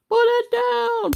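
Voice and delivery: very high, slow, loud order; hammed-up police command; falsetto cop order. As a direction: bark it in a squeaky cartoon falsetto.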